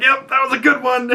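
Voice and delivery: high pinched wince after getting hit; pained squeak. Retold or imitated. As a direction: squeak it out like you just got kicked. Villain kicked in the nuts.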